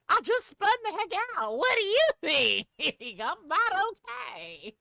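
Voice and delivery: high, loud, laughing disbelief; giddy high laughing shock; laughing panic. Retold or imitated. Reliving crash panic, laughing.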